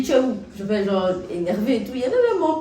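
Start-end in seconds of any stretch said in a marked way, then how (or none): 0.0-0.4 angry demand. Retold or imitated. Angry call to ex on phone.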